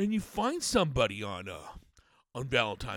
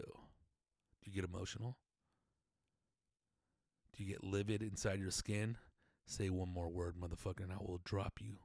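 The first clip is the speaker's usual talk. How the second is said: quiet seething menace; 7 semitones lower, 12 dB quieter than usual. Acted.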